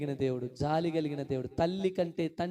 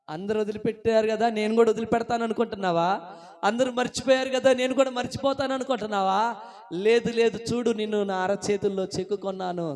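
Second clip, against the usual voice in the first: insistent, raised preacher's voice; impassioned preaching; 5 semitones higher, 5 dB louder than usual. Acted. Jesus reassures a believer who feels forgotten, impassioned preaching. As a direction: press the assurance on the congregation.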